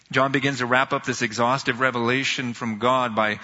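ordinary talk

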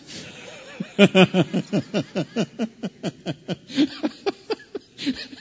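high bursts of amused laughter; real laugh. Real, not acted. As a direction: laugh it off, caught out. Laughing at own impression.

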